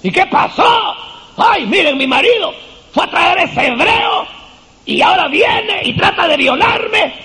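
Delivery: high, shouted false outrage; Potiphar's wife falsely accusing Joseph; hammed-up accusation. Acting Potiphar's wife's false accusation.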